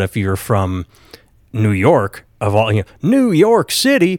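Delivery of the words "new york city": high-pitched, drawn-out mock ad cry; incredulous salsa-ad cowboy send-up; Pace Picante ad impression. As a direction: quote the salsa commercial, stretched out and way up high. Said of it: "Pace Picante".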